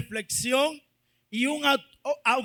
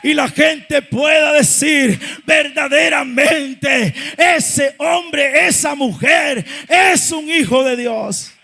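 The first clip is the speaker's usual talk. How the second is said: raised, loud, building preacher voice; full-force preaching; 5 semitones higher, 11 dB louder than usual. Real, not acted. Preacher telling the church they are called to serve, full-force preaching. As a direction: drive each phrase out, stretching the key words.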